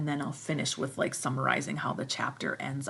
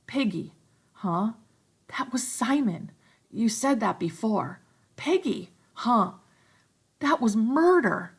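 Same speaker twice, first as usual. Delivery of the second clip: put-on higher, louder shaken boy; stricken Ralph; 6 semitones higher, 6 dB louder than usual. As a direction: confess it in horror.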